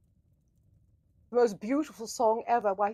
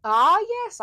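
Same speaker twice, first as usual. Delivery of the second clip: excited; 10 semitones higher, 10 dB louder than usual. Real, not acted. Exclaims on seeing emojis.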